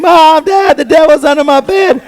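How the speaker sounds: put-on high, loud child's scream for his parents; a scared kid screaming for his parents about the devil, high and loud; terrified scream. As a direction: yell for mom and dad in panic. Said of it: "I start screaming".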